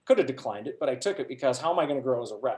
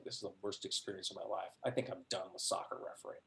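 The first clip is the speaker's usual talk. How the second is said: soft, near-breathless, quick and low; defeated mutter; 5 semitones lower, 13 dB quieter, faster than usual. Retold or imitated. Ref walking off after the worst game, muttering softly. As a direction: mumble it to yourself, beaten.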